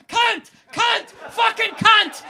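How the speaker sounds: high shrieking yell, each word hurled; furious screaming. Retold or imitated. Fan leaping up and down yelling at racing cars, furious shriek. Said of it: "leaping up and down going".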